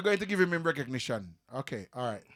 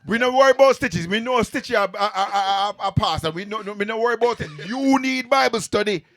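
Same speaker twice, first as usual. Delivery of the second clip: loud, higher teasing; teasing call-out; 5 semitones higher, 7 dB louder than usual. Pretend. Guest loudly teases the host about needing Bible study.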